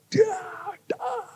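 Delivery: high, drawn-out "dah" for each bump; imitated wave jolts. Preacher imitating a speedboat hitting waves, high stretched noises. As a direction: mimic a boat slapping over waves. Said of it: "Kalau sudah kena ombak itu kayak".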